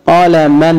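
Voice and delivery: قلما as qalaman; fatha tanween. Shown as a demonstration. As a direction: end قلما in "an". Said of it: "تنوين بالفتح".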